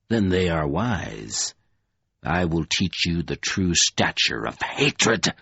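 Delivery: low, slow voice of a scornful dark lord; Lord Fowl menacing. A narrator's reading. Dark lord to kneeling Covenant, low menacing contempt. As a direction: loom over him with cold contempt.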